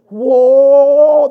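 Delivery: loud burst of awe on the word whoa; sudden loud amazed whoa; excited whoa. Retold or imitated. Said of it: "because I just go".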